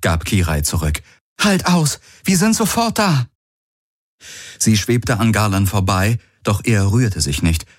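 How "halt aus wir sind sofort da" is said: high-pitched urgent call; urgent encouragement. Acted. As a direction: urge an injured friend to hold on. Kirai ermutigt den Verletzten dringend. (drängend und hoch zugerufen)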